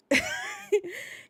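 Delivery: high-pitched burst of laughing; squealing laugh; high laughter. Real, not acted.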